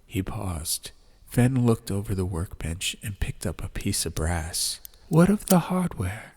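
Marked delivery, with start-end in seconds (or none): 5.1-6.3 formal higher voice; inquire with polite authority; formal gentleman's question. A narrator's reading. Fen asks about hardware.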